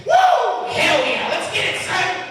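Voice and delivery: high, loud whooping wrestler hype; Ric Flair. Acted. Comic celebrating his weight news too hard, loud wrestler whoop. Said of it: "I Ric Flair a little hard".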